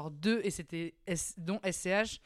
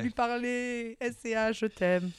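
higher, slow gushing fan voice; starstruck fan gush; 4 semitones higher, slower than usual. Retold or imitated. Retelling her excitement watching [name]'s concert, higher gushing fan. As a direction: swoon like a fangirl over her idol. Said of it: "j'étais en mode".

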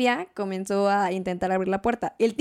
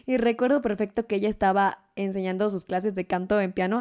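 ordinary talk

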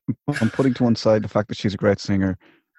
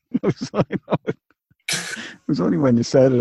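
usual speaking voice

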